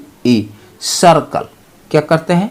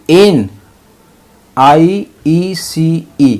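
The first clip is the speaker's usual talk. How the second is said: slow spelling; much slower than usual. Shown as a demonstration. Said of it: "spelling continued".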